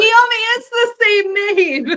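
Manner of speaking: high, stretched-out exasperation breaking into laughter; amused, drawn-out protest; laughing insistence. Real, not acted. Playful exasperated insistence amid laughter.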